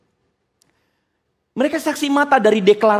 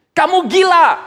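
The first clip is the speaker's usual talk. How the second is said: mock outburst; 8 semitones higher, 4 dB louder, slower than usual. Festus repeats accusation louder.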